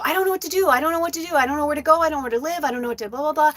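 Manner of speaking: high, rushed overwhelmed pleading; desperate rant. Retold or imitated. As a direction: spill it all out helplessly. Overwhelmed reply to sister.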